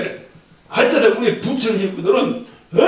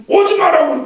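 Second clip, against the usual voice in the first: mock retort; 9 semitones higher, 4 dB louder than usual. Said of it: "내가 뭐라고 해서".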